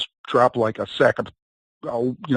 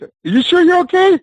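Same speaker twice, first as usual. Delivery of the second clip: very high-pitched, louder question; high, loud retold "you okay?"; imitated concerned check; 5 dB louder than usual. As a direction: mimic someone checking on him in a raised, high voice. Retold Costello checking on fallen wrestler.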